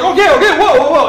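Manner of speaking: high, loud mock-panicked yell; acted urgent attempt to halt someone; alarmed. Medic stopping the spinning patient, alarmed and loud. Medic trying to stop him.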